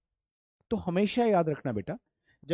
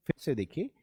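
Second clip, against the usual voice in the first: normal talking voice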